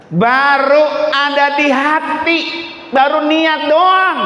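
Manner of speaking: high, stretched-out pulpit proclamation; preaching. Real, not acted. Preacher gives mothers the story's lesson, full-force preaching.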